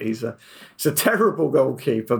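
ordinary delivery

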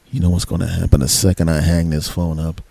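low, taunting phone threat; imagined villainous boyfriend. Retold or imitated. Boyfriend as she must have heard him, villainous. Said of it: "What she must have heard was".

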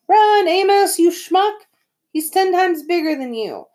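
high-pitched, loud retold warning; excited, high replay of a yell; mock panicked yell. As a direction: act out hollering at a friend to flee. Retelling own panicked cry to run.

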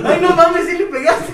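high, loud, laughing shout of amazement; laughing disbelief. Retold or imitated. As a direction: cry out laughing that he really hit it. Friend voicing the video guy.

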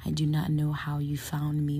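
ordinary talk, nothing marked

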